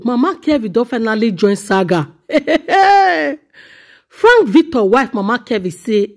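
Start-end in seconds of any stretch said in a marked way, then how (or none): 2.3-3.3 high, ringing laugh; drawn-out exclaiming laugh. Real, not acted. Commentator reacting to the saga, high drawn-out laugh. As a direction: laugh out on a long, high note.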